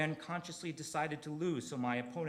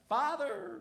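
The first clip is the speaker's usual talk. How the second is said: higher, lightly mocking plea; a teasing echo of Luke's cry; Luke yelling; 12 semitones higher, 5 dB louder than usual. Mocking how Luke calls his father, high plea. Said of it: "I don't know why he talks that way".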